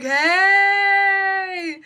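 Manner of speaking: high loud drawn-out hey; loud greeting shout; calling out. Retold or imitated. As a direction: call across the market, loud and high. Vendors calling out.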